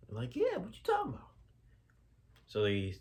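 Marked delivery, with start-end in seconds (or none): 0.3-1.3 very high, squeaky mock disbelief; incredulous squeak. Parents answering the son, high incredulous squeak. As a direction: squeak it like you can't believe it.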